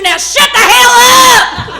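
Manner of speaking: sudden loud shout. Retold or imitated. Grandma snaps at the kids, loud shout. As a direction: bark it out at the kid. Grandma's loving rant turns to yelling.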